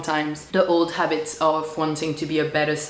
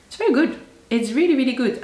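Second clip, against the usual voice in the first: excited; 8 semitones higher, 4 dB louder, faster than usual. Real, not acted. Happy about working through her pile.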